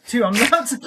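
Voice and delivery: normal talking voice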